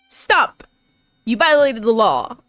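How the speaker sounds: normal talking voice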